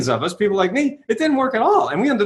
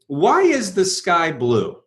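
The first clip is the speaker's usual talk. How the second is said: slow, deliberate question; much slower than usual. Shown as a demonstration. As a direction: ask it slowly and clearly. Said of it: "say to you".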